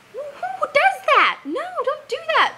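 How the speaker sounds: an outraged "who does that?"; incredulous. Real, not acted. Mocking a pushy way to say it.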